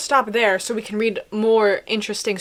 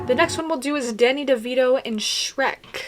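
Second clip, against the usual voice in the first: ordinary delivery, nothing marked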